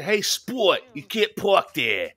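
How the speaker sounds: put-on Philly parking attendant; parking attendant impression. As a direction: call it out in a thick Philly accent. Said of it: "he had an impression".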